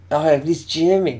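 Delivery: put-on high, loud, slow drunken delight. Drunk fan telling his friend it's Jimmy, drunken excitement. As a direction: play a starstruck drunk. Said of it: "in a pretty drunken voice".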